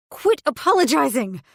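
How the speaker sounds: high, loud furious scream. Acted. Pregnant woman screams at her apologizing mate, furious. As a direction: scream it at him, fed up. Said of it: "I screamed".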